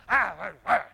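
loud weary groans of a worn-out father; exhausted groan. Acted. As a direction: groan like you dragged yourself home. Tired father comes home.

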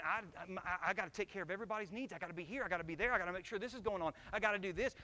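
fast, driven, listing every duty; frantic rushing. Retold or imitated. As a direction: rattle off the duties in a hurry. Retold frantic self.

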